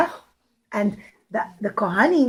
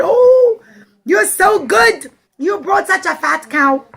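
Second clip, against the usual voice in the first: gushing praise; 7 semitones higher, 5 dB louder, slower than usual. Acted. Crowd admiring rich man.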